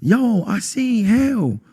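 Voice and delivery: high, drawn-out wail of a plea; re-enacted crying plea. Man telling his girlfriend about hell, mock crying. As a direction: act out a tearful, disbelieved plea, stretching the words. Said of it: "I'm crying".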